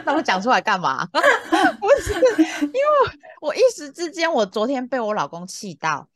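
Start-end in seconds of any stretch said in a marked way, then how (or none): 1.1-3.2 laughing. Real, not acted. Laughing at her own change.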